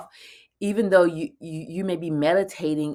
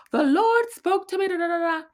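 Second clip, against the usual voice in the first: much higher, louder mock church testimony; churchy testimony voice; 13 semitones higher, 5 dB louder than usual. Quoting churchgoers who hear God, high mock testimony. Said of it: "when you hear people say".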